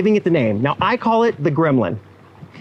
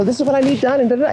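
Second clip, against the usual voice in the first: mock cheery client, much higher pitch; imitating a really cheery client asking for work; 8 semitones higher, much faster than usual. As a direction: chirp it like an upbeat client. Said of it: "coming at you really cheery".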